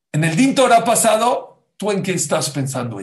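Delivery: loud, high-pitched pronouncement; emphatic declaration. Acted. Rabbi contrasts the last ruling with this one, loudly emphatic. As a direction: ring it out like a preacher making the point.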